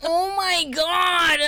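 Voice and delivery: high, slow laughing yell; laughing shout. Real, not acted. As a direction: burst out through laughter. Laughing outburst.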